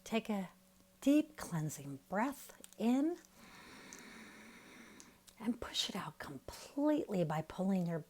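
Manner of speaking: soft, unhurried meditation voice with long pauses; guided meditation. Real, not acted. Teacher guiding listeners to relax their face and jaw, soft meditation voice. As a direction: ease them gently into relaxation, leaving room to breathe.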